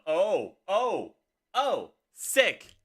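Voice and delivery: high, loud bursts of delight; real excited outburst; excited reaction. Real, not acted. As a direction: exclaim each one sharply, falling from high. Reacts to something happening in game.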